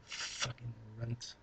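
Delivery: very soft, slow, half-swallowed grumble; muttered aside. Real, not acted. Vlogger grumbling about [name] to himself, muttered. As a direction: mutter it under your breath, off-mic.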